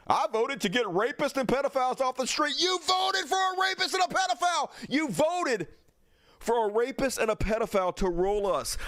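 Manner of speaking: loud, high, relentless yelling; shouting rage at a voter; furious shout. Real, not acted. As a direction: scream the accusation at her.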